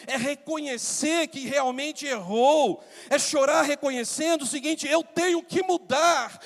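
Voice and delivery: high, loud pulpit proclamation; full-force preaching. Acted. Pastor urging repentance from David's weeping, full-force preaching. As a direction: build to a ringing cry, punching each denial.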